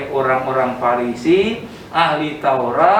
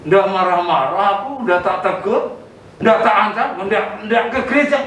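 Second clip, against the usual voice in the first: ordinary talk, nothing marked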